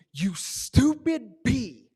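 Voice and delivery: high, loud, exasperated taunt at a bee; yelling at a bee. Retold or imitated. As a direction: scold the bee loudly in a singsong voice. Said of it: "says out loud to this bee".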